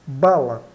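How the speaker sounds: "Bala" dito claro e forte, A aberto; demonstração de pronúncia. Shown as a demonstration. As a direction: pronuncie "Bala" devagar com A aberto. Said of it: "pronunciada bala". ("Bala" com A aberto, bem articulado)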